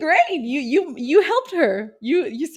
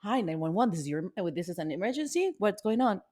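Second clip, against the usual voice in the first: low, flat dispatcher voice; bored operator reading the script; deadpan operator; 4 semitones lower, 8 dB quieter, faster than usual. Pretend. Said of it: "I can imagine the call".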